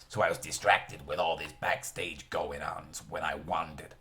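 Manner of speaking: polite apologetic detective, lightly voiced; lightly voiced Columbo. A narrator's reading. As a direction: read Columbo with a light put-on accent. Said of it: "Both the same accent".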